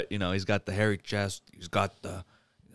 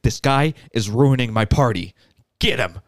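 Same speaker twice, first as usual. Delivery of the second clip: mock angry order; 3 semitones higher, 8 dB louder than usual. Devlin orders his henchmen.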